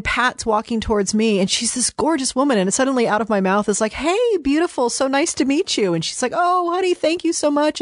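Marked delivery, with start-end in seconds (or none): 3.9-5.9 gushing greeting. Retold or imitated. Greeting Pat on set. 6.3-7.8 high, gushing voice for Pat; sweet Southern hostess. Retold or imitated. Pat's sweet reply voiced.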